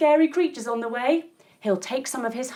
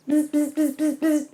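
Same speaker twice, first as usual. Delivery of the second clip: playful buzzing noise; mock bee buzz for kids; 2 semitones higher than usual.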